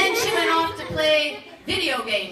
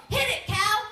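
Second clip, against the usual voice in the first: ordinary talk, nothing marked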